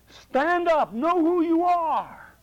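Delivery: high, loud, slow call; rally them to stand tall; rousing command. Real, not acted. Preacher urging the congregation to stand, loud and slow.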